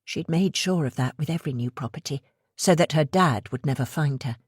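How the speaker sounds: ordinary delivery, nothing marked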